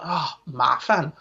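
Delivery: slow exasperated groan of a phrase; mock frustration. Shown as a demonstration. Teacher showing how to complain in Chinese, mock frustration. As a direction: sigh it out, mildly fed up. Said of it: "to get out your frustration".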